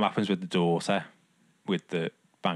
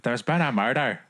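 higher, louder mock-Scottish accent; attempted Scottish; 5 semitones higher, 5 dB louder than usual. Defending the accent after co-host doubts it, attempted Scottish. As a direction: prove it with a hammy Scottish delivery. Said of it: "it's meant to be Scottish".

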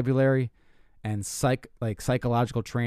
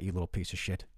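muttered insult; 6 semitones lower, 11 dB quieter than usual. Retold or imitated. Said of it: "I'm like".

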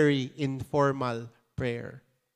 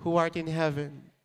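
recited prayer; 3 semitones lower, 4 dB quieter than usual. Acted. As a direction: recite it reverently, fading softer. Congregation recites the Lord's prayer.

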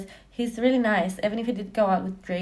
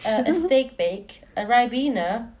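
slow ordering; 3 semitones higher, slower than usual. Acted. Ordering food.

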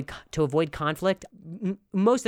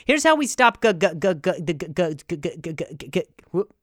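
botched stutter intro; 3 semitones higher, 5 dB louder than usual. Acted. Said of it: "'Cause that sucked".

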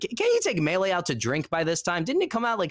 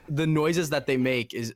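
ordinary talk, nothing marked

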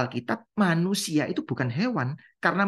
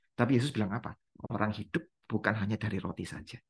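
ordinary talk

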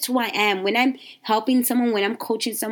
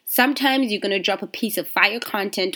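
normal talking voice

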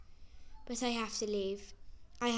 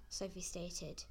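ordinary delivery, nothing marked

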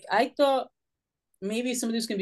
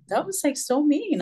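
mild, higher-pitched indignant protest; retold hurt protest; 7 semitones higher than usual. Child protesting at name-calling by kids, mildly indignant. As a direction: replay being wounded by it, pitch raised. Acted protest at being called names.

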